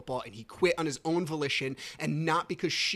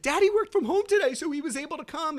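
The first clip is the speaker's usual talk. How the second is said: put-on high child's voice, bursting out loud at first; excited little girl; 13 semitones higher, 5 dB louder, faster than usual. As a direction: imitate a daughter announcing news. Voicing daughter's announcement.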